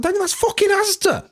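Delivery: very high, strained exasperated cry; exasperated disbelief. Retold or imitated. Dad correcting his son in the car, exasperated. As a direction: squeak it out in utter disbelief.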